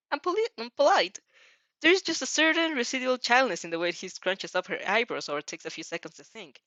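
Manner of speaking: usual speaking voice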